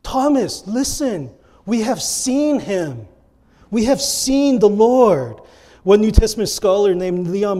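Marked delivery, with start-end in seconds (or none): 0.0-5.4 high, slow, emphatic pleading; urgent insistence, stretching the key words; earnestly persuading. Acted. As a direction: plead to convince a doubting friend. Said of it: "they were earnestly persuading him".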